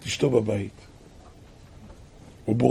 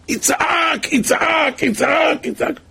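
high, stretched-out pleading shout; repeated wail pitched far above speech; acted crying out; 12 semitones higher, much slower than usual. Preacher shows a debtor facing jail crying to God, high wail. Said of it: "כל אדם יצעק".

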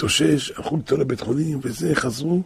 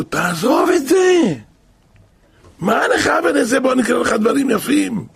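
high, loud scolding shout; a father yelling at his son to drop it; father snaps at his boy over the menorah, loud and high; angry father; 9 semitones higher, 4 dB louder than usual. Retold or imitated.

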